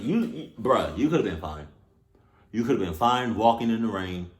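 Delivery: ordinary talk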